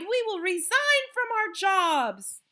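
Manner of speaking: high, loud eager echo; cried out. A narrator's reading. Witches promising to obey, cried out. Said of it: "they cried".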